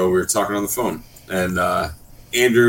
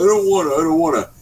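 higher-pitched mock protest; imitated stubborn refusal; 5 semitones higher, faster than usual. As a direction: mimic a friend refusing like a child. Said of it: "we're, like, arguing".

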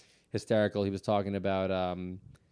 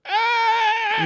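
high, loud comic shriek; retold high-pitched scream; mock terrified scream; 9 dB louder than usual. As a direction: shriek like you're facing death. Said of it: "had this face of death".

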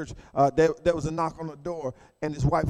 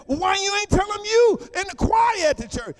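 much higher, shouted protest; exasperated husband; 12 semitones higher than usual. Retold or imitated. Husband demanding why wife hid his choir role, exasperated shout. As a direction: throw the question up in disbelief.

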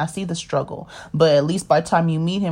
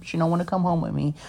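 usual speaking voice

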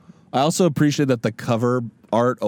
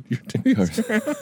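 much higher, squeaky replay; 11 semitones higher than usual. Retold or imitated. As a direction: squeak it out in a high voice. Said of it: "as I said".

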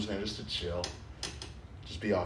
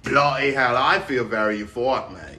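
put-on British accent. To his friend, feeling the weed: mock British accent. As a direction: play a cheery English lad, a bit stoned. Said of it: "different English accents".

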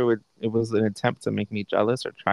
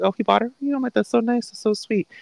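mock sweetness; 12 semitones higher than usual. Mock-sweet reply to bragging.

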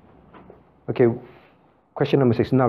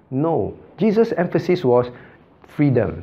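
ordinary talk

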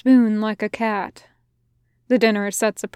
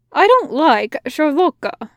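higher, louder teasing soldier voice; 6 semitones higher, 5 dB louder than usual. A narrator's reading. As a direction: tease him, pitched up. Soldier dislikes dessert.

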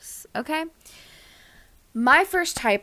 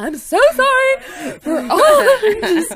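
high, loud, laugh-crying apology; frantic laughing mock apology; laughing panicked apology; 13 semitones higher, 11 dB louder than usual. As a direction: squeal the sorry through laughter.

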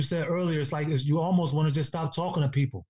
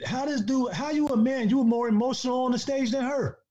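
raised, heated disbelief at a grown man; heated scolding; 6 semitones higher than usual. Real, not acted. Scolding TI for being more emotional than Candace, heated. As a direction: call him out, rising and indignant.

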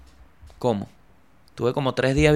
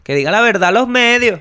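high, loud mocking voice of critics; shrill parody of a commenter; mocking quote; 7 semitones higher, 7 dB louder than usual. Mocking the commenters, in a high loud voice. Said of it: "siempre se lanzan sus comentarios".

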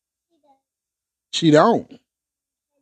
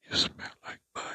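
soft unvoiced whisper; a sneaky whispered tease; 17 dB quieter, faster than usual. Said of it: "If you talk too low".

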